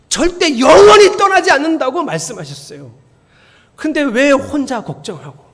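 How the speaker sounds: loud, high pleading rebuke; loud, slowing on the repeated whys; impassioned preaching. Acted. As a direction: press the question on them. Preaches to congregation.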